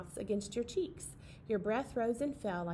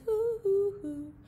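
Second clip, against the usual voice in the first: owl hoots; 8 semitones higher, slower than usual. Pretend. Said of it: "The owl in the tree says".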